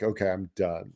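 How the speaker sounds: low, quiet retold surrender; resigned giving up. Angler quitting after losing gear, quietly resigned.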